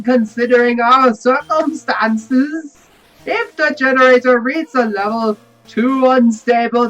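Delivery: booming commander voice. Acted.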